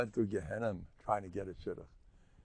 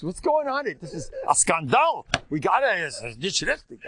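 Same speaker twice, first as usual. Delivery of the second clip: mock outrage; 6 semitones higher, 3 dB louder than usual. Mock outrage over no jokes.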